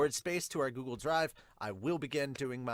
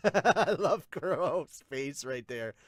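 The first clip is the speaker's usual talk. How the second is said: laughing; 2 semitones higher, 4 dB louder, much slower than usual. Real, not acted. Laughing at a panel.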